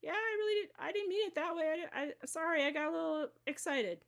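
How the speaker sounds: caught-out, eager sorry; surprised apology. Retold or imitated. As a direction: gush out a startled apology. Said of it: "it might surprise you".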